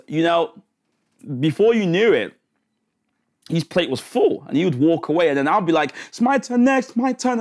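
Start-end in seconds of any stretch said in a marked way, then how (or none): 6.1-7.4 put-on eager child. Eager child waiting in line.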